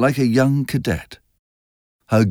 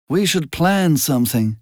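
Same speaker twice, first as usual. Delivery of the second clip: bored; 6 semitones higher than usual. A narrator's reading. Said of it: "said Miss Baker in a bored tone".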